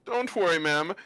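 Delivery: hammed-up gallant reassurance; reassuring talking sword. GM voices sword answering threat.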